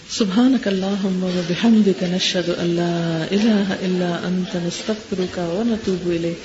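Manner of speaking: slow, measured Arabic recitation of the closing dua; closing prayer. Acted. Teacher ends the lesson with a slow recited prayer. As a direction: draw each word out slowly and reverently.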